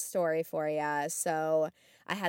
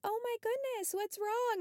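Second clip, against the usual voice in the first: much higher, softer alarmed "oh my goodness"; startled concern; 4 dB quieter than usual. Retold or imitated. Nurse finding her patient frozen in bed, high hushed alarm. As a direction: gasp it out, high and hushed, worried. Finds frightened resident staring at closet.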